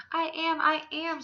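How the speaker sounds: high, loud and urgent cry; silent shout. Acted. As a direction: shout it out to be heard. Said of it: "Sylvester wanted to shout".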